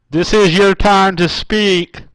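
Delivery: loud, slow shout at the silent line. Acted. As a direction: yell it out, slow and commanding. Host yelling at silent caller.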